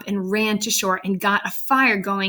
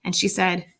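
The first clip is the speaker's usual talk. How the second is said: usual speaking voice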